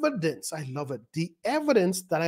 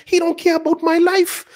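high, loud outcry from the pulpit; raised-voice protest; 11 semitones higher, 7 dB louder than usual. Real, not acted. Preacher objecting to preachers who skip instruction, loud protest. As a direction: cry out in indignant protest.

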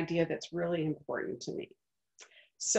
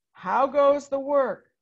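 higher, louder, slow farmer's call; 7 semitones higher, 7 dB louder, slower than usual. Acted. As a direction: call out lazily from bed. Farmer calls again.

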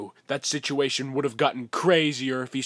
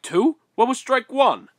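alarmed; 11 semitones higher, 6 dB louder than usual. Acted. Alarmed, high question.

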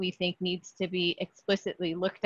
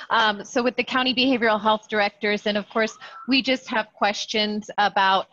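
louder, clearly audible voice; speaking up; 3 semitones higher, 4 dB louder than usual. Real, not acted. Behavioral health speaker restarting for the committee, speaking up. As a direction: project so the whole meeting hears. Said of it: "Much better, thank you".

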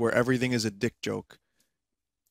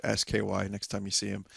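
ordinary talk, nothing marked

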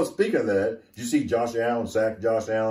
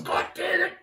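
frustrated outburst. Real, not acted. Frustrated at mixing up teams.

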